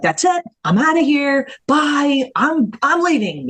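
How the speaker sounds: high, heated snap of leaving; reactive storming off. Shown as a demonstration. Demonstrating reactive distance, a high heated storm-off. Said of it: "reactive distance would be like".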